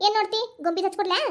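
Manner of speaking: ordinary delivery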